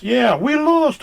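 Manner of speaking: high, loud joke shout; mock shout. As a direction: yell the punchline. Acting out a Muppet joke.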